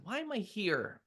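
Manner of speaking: high, puzzled question; high-pitched mom voice; imitating mom. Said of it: "my mom said".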